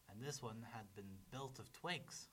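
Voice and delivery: ordinary delivery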